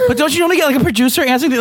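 high indignant. Real, not acted. Arguing with co-host.

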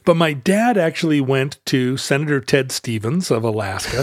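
ordinary talk, nothing marked

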